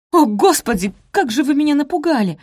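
high, loud, startled cry; startled exclamation. A narrator's reading. Maid startled by mistress bursting in, exclaiming. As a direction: exclaim as if just given a fright. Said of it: "воскликнула она".

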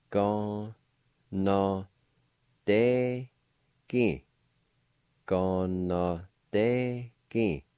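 each syllable apart with pauses. Shown as a demonstration. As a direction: break the word into its syllables. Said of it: "it's pronounced like this".

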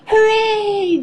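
high, drawn-out gleeful cheer. A narrator's reading. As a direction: let out a child's high hooray. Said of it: "cheers Peppa".

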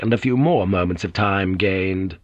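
normal talking voice

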